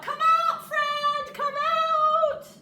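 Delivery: high, loud sing-song shout. A narrator's reading. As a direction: shout it up into the tree. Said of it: "Mouse starts to shout".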